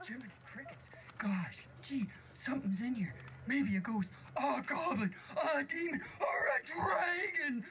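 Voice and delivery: high dwarf voice climbing to a cry; fretful dwarf. Acted. Dwarf guessing what's inside, rising to a panicked cry. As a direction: build from worried to a panicked cry.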